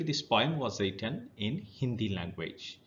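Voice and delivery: ordinary talk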